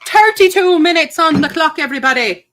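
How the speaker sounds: put-on Irish accent. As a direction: announce the time in a hammy Irish brogue. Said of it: "it just triggers my Irish".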